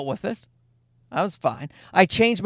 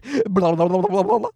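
rapid babbled nonsense syllables; tongue-rolling mock blabber; mock French gibberish. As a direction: imitate incomprehensible fast foreign speech. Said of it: "she turned around and went".

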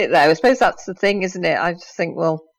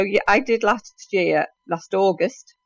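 ordinary talk

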